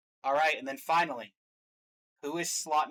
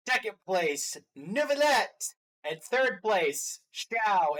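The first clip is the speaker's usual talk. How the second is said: higher, punched winners' announcement; show announcer; 5 semitones higher, 4 dB louder, much slower than usual. Pretend. Host reveals the final top three, raised announcer voice. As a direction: call out the placings like an awards host.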